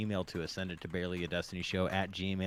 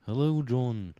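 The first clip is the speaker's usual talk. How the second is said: put-on British accent; 5 semitones higher, 7 dB louder, slower than usual. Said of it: "I just pulled the dad tails".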